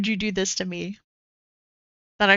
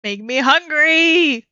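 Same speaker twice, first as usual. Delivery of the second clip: excited; 5 semitones higher, 5 dB louder, slower than usual. Real, not acted. Reacting to tasty food on stream.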